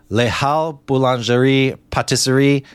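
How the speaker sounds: a try at a French accent on the name. Shown as a demonstration. Host tries a French accent on the bakery name. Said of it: "Don't know if my French accent is any good".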